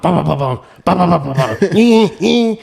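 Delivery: high stuttering car-stall noise; imitated stalling engine. Learning stick in dad's car, imitated engine stall sputter. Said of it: "I'm stalling out".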